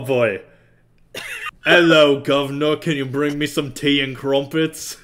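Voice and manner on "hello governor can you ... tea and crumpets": put-on British accent. Friend reluctantly repeating a fed line, shaky-confidence British accent. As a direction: have a go at British, slowing down. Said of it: "better than your British accent".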